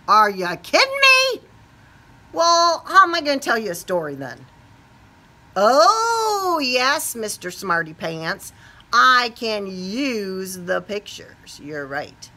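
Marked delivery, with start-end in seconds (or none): none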